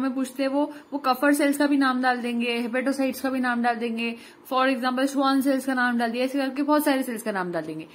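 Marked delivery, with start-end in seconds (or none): none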